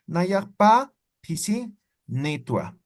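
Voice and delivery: naya pa pisinitua stretched out slowly; slow model answer. Shown as a demonstration. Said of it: "Entonces digamos".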